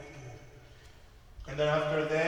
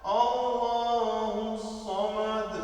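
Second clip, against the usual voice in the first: high, chanted recitation of the surah; Quran recitation; 7 semitones higher than usual. Shown as a demonstration. Teacher reciting Al-Ikhlas for the students, chanted.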